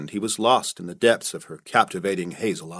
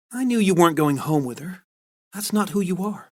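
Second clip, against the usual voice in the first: Carla reassuring; 9 semitones higher than usual. Acted.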